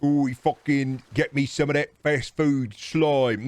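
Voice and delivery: foreign visitor. Retold or imitated. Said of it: "people that'll come from a different country".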